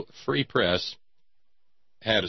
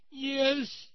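high-pitched mock little-girl voice; crying little girl; 3 dB quieter than usual. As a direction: play a wronged child tattling to her parents, very high. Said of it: "And she said".

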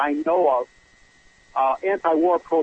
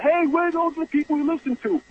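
imitated yelling; 9 semitones higher than usual. Caller imitates hecklers around him, high mock yelling. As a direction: mimic a protesting crowd in a high voice. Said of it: "they were still yelling".

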